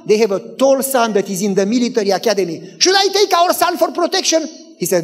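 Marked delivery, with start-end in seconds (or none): 2.8-4.5 high, urgent mock worry; worried wife imitation, pitched up throughout; anxious. Wife wanting protection at the neighbor's, anxious.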